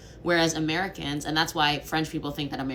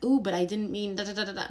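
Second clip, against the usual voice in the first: higher, put-on anxious backpedalling; mock hedging; 5 semitones higher, faster than usual. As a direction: parody an apologetic disclaimer. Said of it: "sensibility of being like".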